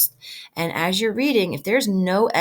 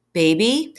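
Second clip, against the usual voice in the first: ordinary delivery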